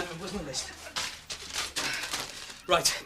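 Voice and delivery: ordinary delivery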